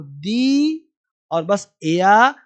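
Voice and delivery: दी held long, then a stretched एया; broken into parts. Shown as a demonstration. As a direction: split the word slowly into दी and एया, no र. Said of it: "इसे पढ़ना है, देखो". (दी और एया, खींचकर अलग-अलग)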